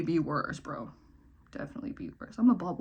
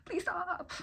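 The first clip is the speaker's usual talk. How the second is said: mock cringe, rising high on please stop; embarrassed high-pitched plea; cringing at herself; 6 semitones higher, 3 dB quieter, slower than usual. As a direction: squeal it like you cannot bear your old self. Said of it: "cringe and be like".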